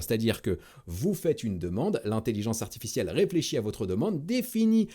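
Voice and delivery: ordinary talk, nothing marked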